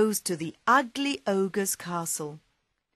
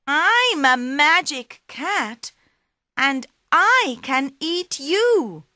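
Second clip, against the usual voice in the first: higher, slower, louder cat boast; cheeky cat; 8 semitones higher, 8 dB louder than usual. Acted. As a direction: puff yourself up like a small cat. Puss threatens back.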